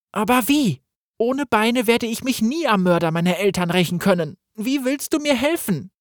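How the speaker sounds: put-on high, pleading young man's voice; plead, crippled and hopeless; desperate young Gareth. Crippled Gareth asks the voice how it can help, desperate.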